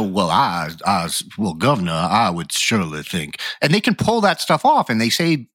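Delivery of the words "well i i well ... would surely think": put-on lower, lazy good old boy Southern accent; Southern drawl. Mocking Southern politicians to the podcast hosts, in a Southern drawl. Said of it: "that was a great accent".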